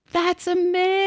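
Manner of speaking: high, wondering "amazing"; properly amazed. Shown as a demonstration. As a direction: say it like you mean it. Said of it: "let me say that right".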